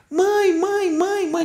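high, pleading mãe, a light imitation; desperate call for mother. Suffering spirit calls the rescuer mother, lightly desperate. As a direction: cry out like a lost suffering soul. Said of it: "é de uma forma desesperadora".

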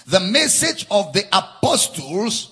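ordinary delivery

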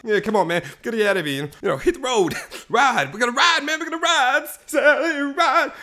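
high mock-hysterical pleading; freaking out. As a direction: build from nervous urging to a tearful-sounding wail. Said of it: "they're freaking out".

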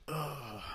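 pained ugh groan; crying-edged ugh. Real, not acted. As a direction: groan it out in frustration. Groan after outburst.

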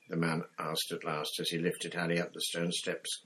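ordinary talk, nothing marked

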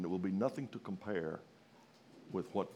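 usual speaking voice

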